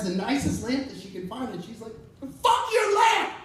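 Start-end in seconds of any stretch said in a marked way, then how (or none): 2.2-3.3 screamed slow and much higher, very loud; furious shout. Retold or imitated. Mother smashing a lamp at the meeting, shrieking. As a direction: hurl it out in a shrieking rage. Mom's outburst in retold story.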